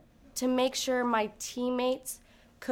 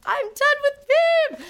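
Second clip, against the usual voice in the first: much higher, louder, slow and stretched out; a slow, high, loud shout after the beam dismount; ecstatic shout; 9 dB louder, slower than usual. Retold or imitated. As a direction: yell it out in pure relief. Repeats louder.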